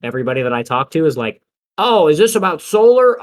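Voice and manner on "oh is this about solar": louder, higher mock exclamation; imitating exasperated homeowners. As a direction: act out a homeowner cutting in, loud and high. Acts out fed-up homeowners' complaint.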